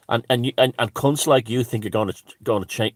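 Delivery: normal talking voice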